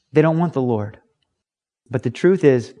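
normal talking voice

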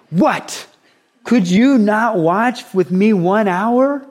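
emphatic rebuke. Acted. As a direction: press each word in emphatic disbelief. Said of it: "It was probably a little more emphatic".

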